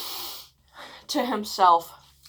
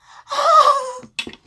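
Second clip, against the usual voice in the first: loud, high, long gasp; horrified gasp; 15 dB louder than usual. Retold or imitated. Reacting to his question about killing, loud horrified gasp. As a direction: act out a big shocked gasp.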